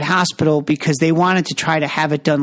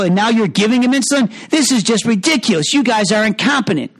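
accusing daughter; 5 semitones higher than usual. Retold or imitated. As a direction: pile on the accusations. Daughter voiced accusing doctors.

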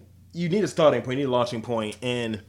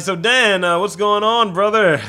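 higher, louder buddy greeting; bro voice; 7 semitones higher, 8 dB louder, slower than usual. Pretend. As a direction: greet him like an overeager bro. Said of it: "I'm like".

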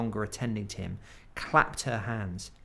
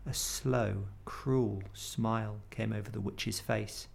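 ordinary delivery, nothing marked

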